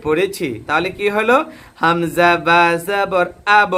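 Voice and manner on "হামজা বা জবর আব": slow, drawn-out spelling chant; slow recitation drill. Shown as a demonstration. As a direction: chant each letter slowly for learners. Teacher chants spelling drill again.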